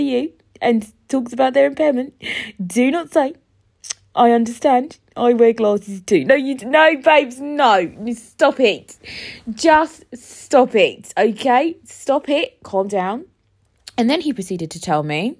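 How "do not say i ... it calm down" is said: louder, firm telling-off; stern scolding. Real, not acted. Telling listeners off about glasses, loud and firm. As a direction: scold them like a parent.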